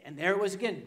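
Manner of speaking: ordinary talk, nothing marked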